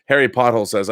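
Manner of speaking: ordinary talk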